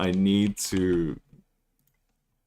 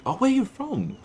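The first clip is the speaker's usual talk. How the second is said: curious stranger. Retold or imitated. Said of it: "It was like".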